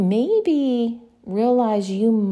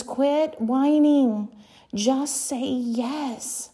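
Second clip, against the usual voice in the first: preacher pressing the listener to say yes; slow emphatic urging; 3 semitones higher, much slower than usual. Real, not acted. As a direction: lay each word down slowly.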